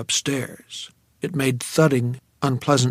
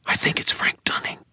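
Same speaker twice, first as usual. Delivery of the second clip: hushed, unvoiced whisper; 4 dB quieter than usual. A narrator's reading. Dreamer whispering to Sadie in fear. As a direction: whisper it so the thing upstairs won't hear. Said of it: "I whispered to Sadie".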